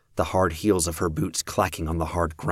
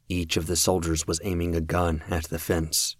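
plain reading voice

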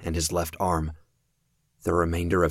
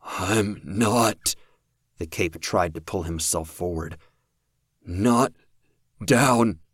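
strained; slower than usual. Acted. Impaled man refuses to die.

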